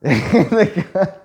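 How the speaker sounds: usual speaking voice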